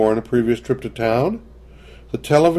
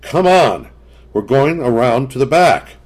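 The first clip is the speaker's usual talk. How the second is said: louder, higher angry order; shouting; 4 semitones higher, 6 dB louder than usual. A narrator's reading. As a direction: push everyone round the back, loud. Paul shouting at the door.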